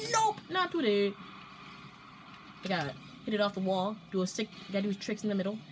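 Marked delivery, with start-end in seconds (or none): 0.1-1.1 high, drawn-out "nope"; mock-cool denial; cool refusal, hammed up. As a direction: playfully wave off the shot, slow and high. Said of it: "You gotta be like".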